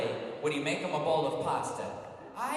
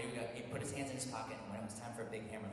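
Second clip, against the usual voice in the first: ordinary delivery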